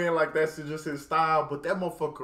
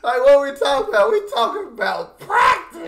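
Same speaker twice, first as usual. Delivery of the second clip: gleeful; 7 semitones higher, 9 dB louder than usual. Real, not acted. Quoting the lyric gleefully.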